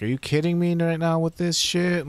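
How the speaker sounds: are you kidding me, exasperated; exasperated outburst. Real, not acted. Frustrated at broken quest.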